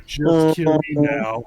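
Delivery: lightly voiced depressed robot; Marvin voice. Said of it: "Marvin would go on".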